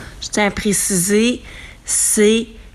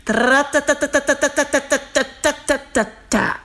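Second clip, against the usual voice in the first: imitated machine rattle; 4 semitones higher than usual. Imitating the washing machine noise.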